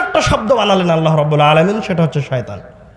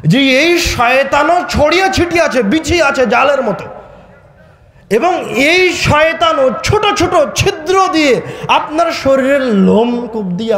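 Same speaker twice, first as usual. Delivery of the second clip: higher-pitched, emphatic sermon delivery; forceful preaching; 5 semitones higher than usual. Real, not acted. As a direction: drive each phrase home to the congregation.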